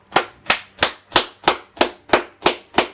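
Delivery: breathless silent laugh; wheezing laugh. Real, not acted.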